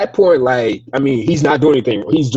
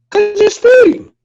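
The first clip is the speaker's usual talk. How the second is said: much higher, louder, slow cop voice; mock cop voice; 6 dB louder than usual. Mimicking a cop answering the driver, high and slow. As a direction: play the cop stating the obvious.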